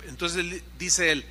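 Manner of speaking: ordinary delivery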